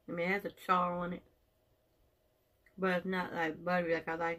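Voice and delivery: talking while chewing food; words muffled by food; mouth full, chewing. Real, not acted. Seen 2: Chews with hand at mouth, holding sprout while talking early on.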